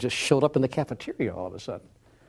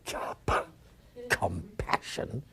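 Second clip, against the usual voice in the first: halting stammer, then slow drawn-out word; struggling to say it; 2 semitones lower, 6 dB quieter, much slower than usual. Retold or imitated. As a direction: choke on the first sounds, then squeeze the word out slowly. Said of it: "having a hard time saying".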